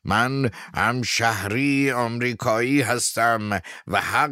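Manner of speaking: loud, high, slow defiant shout. Acted. Abe Fields defending his right to protect the elephants, shouting. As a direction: proclaim his rights at full voice, slowly. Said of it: "ایب فیلدز فریاد زد".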